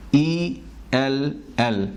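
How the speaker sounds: ایل said slowly and clearly as "el"; slow letter demo. Shown as a demonstration. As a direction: spell out ای ایل ایل slowly, each sound clear. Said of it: "صحیح تلفظ ایل ہے".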